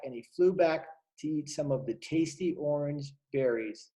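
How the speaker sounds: usual speaking voice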